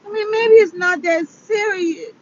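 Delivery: high tearful voice; plaintive, high whimper through real tears; crying. Real, not acted. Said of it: "I'm sitting up here crying".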